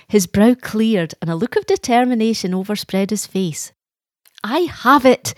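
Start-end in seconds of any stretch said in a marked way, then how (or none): 4.4-5.3 sudden burst of excitement, higher and louder; excited exclamation. A narrator's reading. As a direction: blurt it out with excitement. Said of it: "he exclaimed excitedly".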